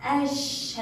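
"أش" going straight into sh, no L; high short a, then a held ش in أش; silent lam. Shown as a demonstration. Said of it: "هل لفظتها؟".

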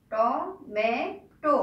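Tomato stretched very slowly, syllable by syllable; teacher drags out tomato for children writing it down, very slow; drawn-out dictation. Shown as a demonstration. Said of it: "কি?".

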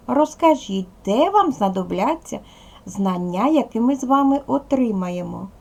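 ordinary talk

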